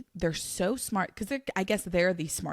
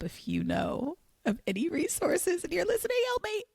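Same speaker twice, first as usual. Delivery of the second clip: mock plea for help; 13 semitones higher than usual. Real, not acted. Host begging listeners for rescue tips, high pleading. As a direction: beg for help in a thin high voice. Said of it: "this is my cry for help".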